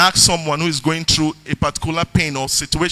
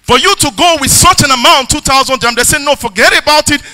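raised, loud preacher's voice driving the sermon; preaching; 5 semitones higher, 5 dB louder than usual. Acted. Pastor preaching his testimony and scripture to the church, loud and fervent. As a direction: preach it full force to the congregation.

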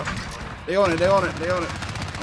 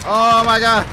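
loud exclamation; 3 semitones higher, 9 dB louder than usual. Real, not acted. Sudden loud reaction in match.

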